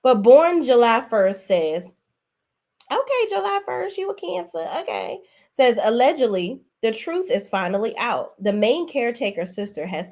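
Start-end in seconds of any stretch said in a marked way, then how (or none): 2.9-5.2 much higher, softer, mocking sing-song. Vlogger mocking a commenter's astrology, in a high mock voice. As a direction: pitch it way up like you're poking fun.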